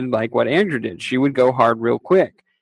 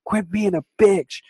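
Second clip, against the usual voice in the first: higher mock scolding; bossy drunk wife; 8 semitones higher than usual. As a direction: nag her sister to drink up. Drunk wife scolds crying sister.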